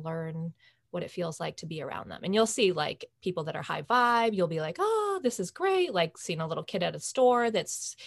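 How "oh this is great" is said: delighted gush. Retold or imitated. Imitating reaction to high-vibe people.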